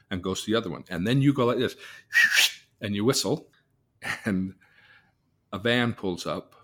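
ordinary talk, nothing marked

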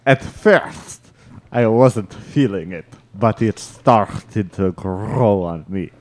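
put-on Yugoslavian accent with rolled R's. Rereading a correspondent's line after a complaint, Yugoslavian accent. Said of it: "what is a Yugoslavian accent".

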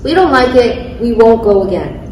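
ordinary talk, nothing marked

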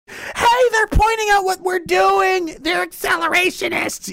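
very high, shouted squawk; hammed-up tattletale panic; mock alarmed accuser. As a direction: raise the alarm in a high put-on voice.

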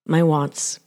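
ordinary delivery

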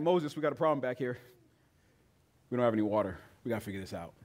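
mock-polite report to Moses; polite people reporting a problem, calm; civilized complaint. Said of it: "a civilized complaint".

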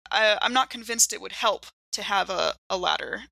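normal talking voice

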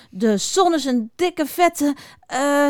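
high, slow grumbling, searching for an insult; mopperend. A narrator's reading. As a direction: grumble and grope for the word. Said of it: "Zo mopperden ze nog een tijdje door". (traag, hoog gemopper)

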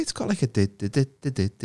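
"dit, dit, dit" spoken as even beats, one per syllable; name rhythm tapped out. Shown as a demonstration. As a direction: tap out "dit dit dit" like a drum beat. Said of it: "It's got a good rhythm".

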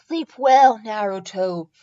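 ordinary delivery

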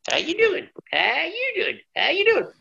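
put-on high hillbilly voice with a Southern accent, muttered to himself; Sting greeting nobody on the way to the toilet, Southern accent. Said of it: "Talking to himself".

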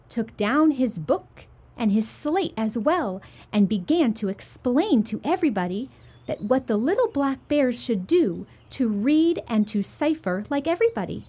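plain reading voice

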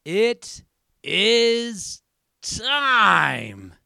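big drawn-out ring announcer call, high and loud; booming announcer hype, long falling last word; Bruce Buffer impression. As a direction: stretch each word like a fight announcer. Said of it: "That was my Bruce Buffer impression".

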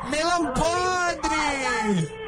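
high, slow, drawn-out insults building to shouts; mock insulting diva. Prank caller insults the woman on the phone, high slow mock shouts. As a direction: hurl each insult like a catty diva.

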